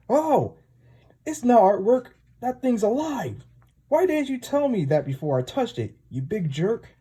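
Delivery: very high-pitched cartoon squeal of shock; squeaky, indignant panic; alarmed outburst. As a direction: play a startled little sidekick, voice way up. Puck shocked the egg is alive.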